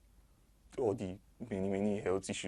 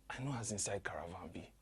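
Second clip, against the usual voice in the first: whisper; 6 dB quieter than usual. Acted. Confiding aside to audience.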